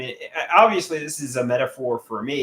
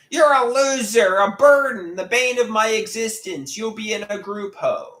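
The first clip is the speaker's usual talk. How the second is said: high, loud imitation of a yelling mother; retold mother yelling; 8 semitones higher, 5 dB louder than usual. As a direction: throw the insults back as mom did, loud and high. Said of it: "my mother's yelling".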